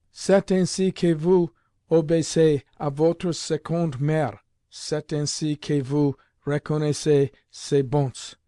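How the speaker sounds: tutor's French reproach. A narrator's reading. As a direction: reproach the pupil in a put-on tutor's voice. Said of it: "remarked Saint Jerome quietly".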